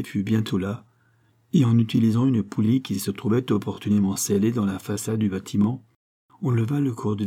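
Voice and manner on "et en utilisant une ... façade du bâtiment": ordinary delivery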